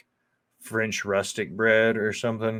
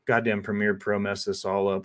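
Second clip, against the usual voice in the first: ordinary talk, nothing marked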